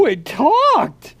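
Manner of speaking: high, slow amazed "oh"; real surprise, drawn out; surprised. Real, not acted. Friend hears the toy lightsaber talk, slow amazed delight.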